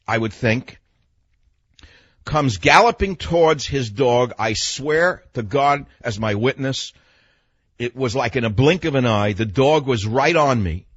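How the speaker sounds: normal talking voice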